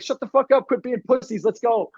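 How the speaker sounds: mock team yell. Retold yelling at teammates.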